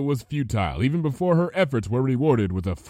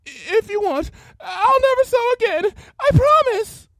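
desperate tearful plea; 8 dB louder than usual. Acted.

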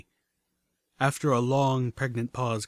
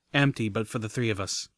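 ordinary delivery, nothing marked

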